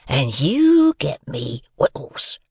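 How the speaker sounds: slow, emphatic, drawn-out order; menacing convict. A narrator's reading. Convict tilts the boy and gives orders, menacing. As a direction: draw out each order as a threat.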